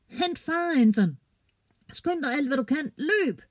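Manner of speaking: high urgent yell; shouting. Acted. Trylle yelling to Anton to fetch dad, high urgent shout. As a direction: call it out high and urgent across the field. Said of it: "råber hun til Anton".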